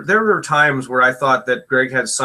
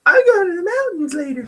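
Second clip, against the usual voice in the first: high mock sing-song voice. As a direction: gush it in a high dreamy voice. Mocking herself after sweet invitation.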